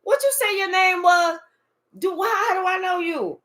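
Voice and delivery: high, loud fake astonishment; a hammed-up who-are-you squeal; mock surprise. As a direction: play dumb in exaggerated disbelief. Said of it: "You was even like".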